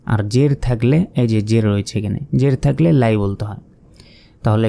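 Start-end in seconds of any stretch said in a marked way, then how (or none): none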